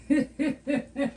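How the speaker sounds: high-pitched giggle; light, high chuckle to yourself; amused little titter. Real, not acted. Chuckles after joking about sharing.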